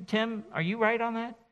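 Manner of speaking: high mock-skeptical questioning voice; doubting churchgoer. Churchgoer challenging Tim on Scripture, mock-skeptical and high. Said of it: "then you'll be able to say".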